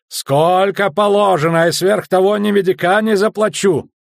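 loud, high protest; shouting merchant. A narrator's reading. Merchant refusing to pay extra duty, shouting. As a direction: yell your refusal across the deck. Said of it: "раскричался с середины палубы".

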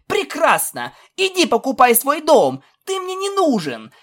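high, shouted filly comeback; angry retort. A narrator's reading. Filly tells her friend to get lost, angry retort.

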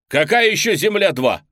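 high, loud furious shout; angry shout. Acted. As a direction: yell it out in disbelief. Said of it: "кричу я".

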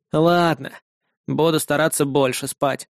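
low, grudging mumbled concession; reluctant. A narrator's reading. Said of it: "нехотя ответил он".

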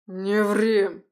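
loud angry bark. Acted. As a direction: snap it out loudly. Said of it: "рявкнул Лис".

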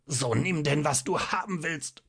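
high, strained, teeth-gritted fury; strained rage while being choked; grinding out words. A narrator's reading. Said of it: "knirschte der Doktor".

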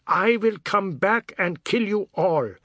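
shouting a promise of revenge; shouted. Acted. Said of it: "Pratap shouted".

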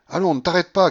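high, loud urging cry; shouted command. A narrator's reading. Grandmother shouting at porters not to stop, commanding. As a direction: shout to keep them moving. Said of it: "cria la babouchka".

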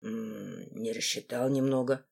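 low, soft admission; repentant mumble. A narrator's reading. Руфус owning up to Сильвана, low and sheepish. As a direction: own up quietly in a low voice. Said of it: "покаялся Руфус".

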